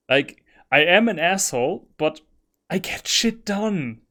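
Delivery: loud, eager gamer chatter; excited. Real, not acted. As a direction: gush about the game loudly. Excited commentary on the game.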